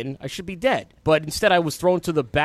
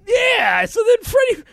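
excited; 7 dB louder, slower than usual. Real, not acted. Excited reconsideration on air.

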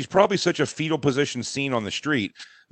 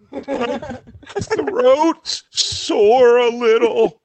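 high, drawn-out mock cry; imitation crier; 10 semitones higher, 6 dB louder, much slower than usual. Husband imitates wife whining about her throat, mock crying. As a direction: whimper it slowly, hammed up. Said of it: "so she's a crier".

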